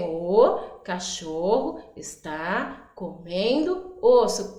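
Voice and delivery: slow, each word set apart for counting. Shown as a demonstration. Teacher counting words with children, slow and separated. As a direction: space out every word so children can count them. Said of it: "Vamos contar quanto tem?".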